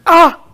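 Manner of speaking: high, loud startled cry; sudden acted scream of a cut; sharp yelp of pain. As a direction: yelp as the knife slips. Woman cuts her hand.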